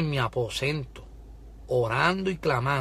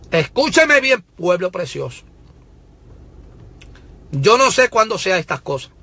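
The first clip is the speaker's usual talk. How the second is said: raised, loud prophetic preaching; fiery pulpit proclamation, higher and louder; full-force preaching; 8 semitones higher, 6 dB louder than usual. Real, not acted. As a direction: proclaim it like a prophet warning the nation.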